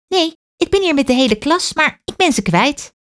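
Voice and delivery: put-on high, worried child voice; lost little girl. A narrator's reading. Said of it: "girl answers the man".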